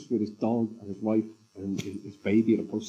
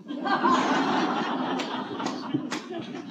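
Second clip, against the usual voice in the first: high, unvoiced wheezing laugh; silent, breathless laughter of relief; laughing hard with relief; breathy relieved laugh. Real, not acted. Relief at punchline.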